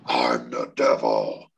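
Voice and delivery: very low, slow demonic drone; evil devil voice. Acted. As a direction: drop way down into a possessed toaster's devil. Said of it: "she uses an evil voice".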